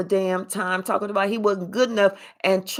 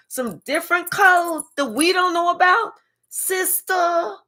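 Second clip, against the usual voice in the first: mocking comeback; 7 semitones higher than usual. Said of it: "They was like".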